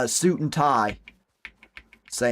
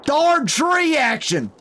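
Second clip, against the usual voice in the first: frustrated yell; 9 semitones higher, slower than usual. Real, not acted. Yelling at tree in game.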